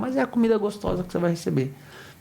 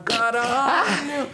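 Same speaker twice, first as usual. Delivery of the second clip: drawn-out curse; 5 semitones higher, much slower than usual. Retold or imitated. Exasperated parent.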